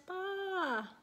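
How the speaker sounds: very high, long lamb "baa"; distant bleat. A narrator's reading. Lamb heard by the shepherd across the valley, high distant bleat. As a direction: bleat high as if from far away. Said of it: "he heard in the distance".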